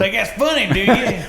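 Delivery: high, loud mock challenge; mock confrontational cop. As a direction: challenge them like an outraged cop. Mimics the cop confronting them.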